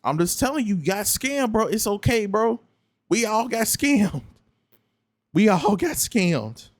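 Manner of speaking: high-pitched acted telling-off, climbing sharply midway; putting them in place. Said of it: "put them in a place and be like".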